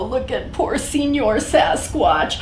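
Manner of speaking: ordinary delivery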